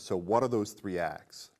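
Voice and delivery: ordinary talk, nothing marked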